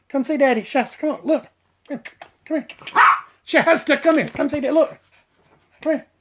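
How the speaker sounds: calling the dog. Real, not acted.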